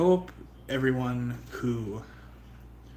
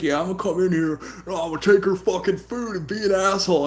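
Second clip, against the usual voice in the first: higher, louder mock cat-bully voice; voicing the bully cat; 7 semitones higher, 7 dB louder than usual. Streamer voicing the cat that bullies Eureka, mock bully. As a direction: play the cat as a swaggering jerk. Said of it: "the dude's just like".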